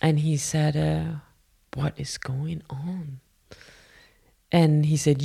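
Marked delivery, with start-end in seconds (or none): 1.7-3.2 slow, very soft whisper; retold grave question. Teacher coming to the bathroom, slow hushed question. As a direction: draw it out in a hush.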